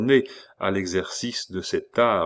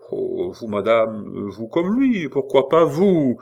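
hesitant concierge; 2 semitones higher, 5 dB louder than usual. Acted. Hubert hesitant reply.

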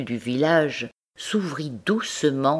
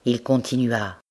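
plain reading voice

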